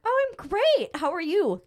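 overly chipper mask over panic; fake cheer. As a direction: sell the lie to a patient with a forced smile.

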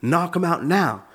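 mock-intense corner coach; boxing coach. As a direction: imitate a coach urging on a fighter in the last round. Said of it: "my coach is in the corner".

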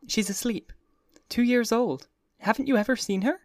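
put-on high young woman, hushed then bright; airy society girl asking; Daisy's voice. A narrator's reading. Daisy voiced talking of baby.

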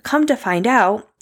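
ordinary talk

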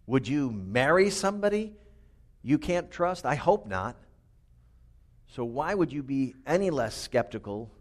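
normal talking voice

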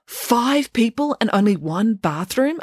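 slow, high disbelieving rise; incredulous question. Acted. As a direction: drag it out in disbelief. Melanie shocked by sharing.

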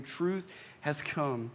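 hushed, weighty slow statement; slow solemn declaration. Acted. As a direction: drop to a soft, very slow proclamation.

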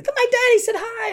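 high-pitched imitation of an excited child; little girl. Little girl greeting her camp buddy, high child voice. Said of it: "every time she sees me".

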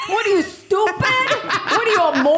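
high mock yell, laughing; hammed-up shouting Italian parent. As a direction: berate a kid, laughing. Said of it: "the Italian parent goes".

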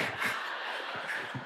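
soft breathy chuckle; short airy laugh; quiet laugh. Real, not acted. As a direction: laugh under your breath at the joke. Reacting to friend's joke.

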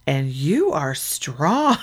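slow, drawn-out admiring compliment; a dazed mom admiring the firefighter, slowly. Retold or imitated. Mom admiring the firefighter, slow drawn-out compliment. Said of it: "and she's like".

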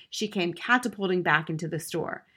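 usual speaking voice

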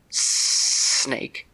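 drawn-out whispered hiss; hissing snake. Acted. Green snake telling Terry its name, in a drawn-out hiss. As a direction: stretch the word into a hiss.

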